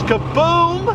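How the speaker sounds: high, drawn-out "kaboom"; explosion sound effect. Shown as a demonstration. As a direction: stretch out the boom of impact. Imitates the bullet's impact.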